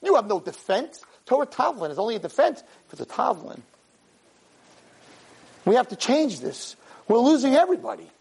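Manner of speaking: ordinary delivery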